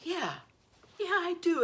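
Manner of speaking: giddy high-pitched admission; gleeful high yes. Retold or imitated. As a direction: crow it triumphantly. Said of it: "I go".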